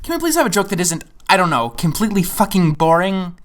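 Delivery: ordinary delivery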